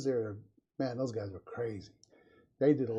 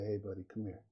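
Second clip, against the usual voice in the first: gentle fatherly call; 4 semitones lower, 6 dB quieter than usual. Retold or imitated. Parent gently calls his child over.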